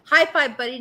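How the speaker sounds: proud parent's hearty congratulations; congratulating cheer. Acted. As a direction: cheer on your kid's win. Voices cheering on son's sale.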